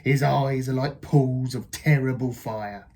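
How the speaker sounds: put-on scare the child with the tale; spooky Gruffalo dad. Dad describes the scary mouse.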